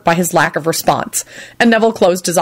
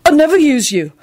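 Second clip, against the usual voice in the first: higher-pitched, earnest pronouncement; determined promise, pitch raised; 7 semitones higher, 3 dB louder than usual. A narrator's reading. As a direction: declare it with firm resolve. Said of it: "he pronounced determinedly".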